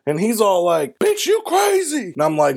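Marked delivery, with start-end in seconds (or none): 1.0-2.1 high-pitched imitation of the chased man; alarmed mock cry. Chased gunman answering the driver, alarmed. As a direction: squeal it as the man being chased. Acting out the chased man's reply.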